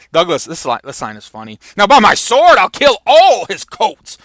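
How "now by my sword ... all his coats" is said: loud, high theatrical oath; big declaimed threat; acted battle vow. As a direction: swear it boldly as a stage warrior. Douglas vows to kill impostors.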